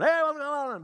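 loud, high-pitched mock phone greeting; speakerphone greeting. Dad answering on speakerphone in the car, loudly hailing the caller. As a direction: imitate dad hollering into a speakerphone. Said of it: "He's on speakerphone".

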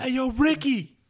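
high-pitched holler for a coworker; calling out. Acted. Acted calling out to a store worker.

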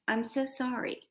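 soft, heartfelt I'm so sorry; mean every word, gently; softly sincere, said from the heart; sincere apology. Shown as a demonstration. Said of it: "speaking them with true intent".

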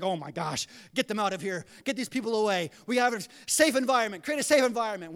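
mock alarm building to loud urgency; hammed-up panic, rising in volume; worked up. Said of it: "we all get all worked up".

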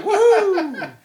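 high cheering woohoo through a laugh; high whoop. Real, not acted. Host whooping for co-host through a laugh.

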